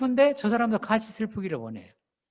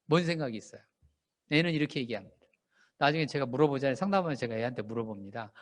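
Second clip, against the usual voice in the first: ordinary delivery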